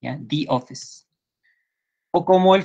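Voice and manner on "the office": "The office" with "The" said "di", closed vowel; "the" turns into "di" before "office". Shown as a demonstration. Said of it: "I, the".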